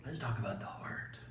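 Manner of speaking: soft conspiratorial whisper. Acted. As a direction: lean in and whisper it. Hushed topic announcement.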